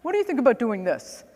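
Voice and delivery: imitating the lawyer's Long Island accent, mildly; the lawyer pitching the idea, in a light Long Island accent. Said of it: "I'm trying to do the accent".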